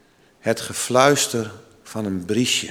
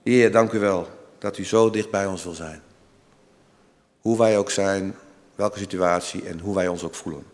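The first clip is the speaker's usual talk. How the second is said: prayer; 4 semitones lower than usual. Acted. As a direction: pray it gently, hushed. Closing prayer.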